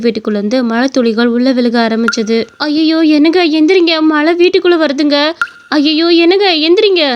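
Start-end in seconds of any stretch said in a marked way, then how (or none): 2.7-7.0 higher-pitched, urgent wife voice; alarmed wife. Acted. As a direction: wake your husband in alarm. Wife wakes husband as rain leaks. (பதற்றத்துடன் எழுப்பும் மனைவி)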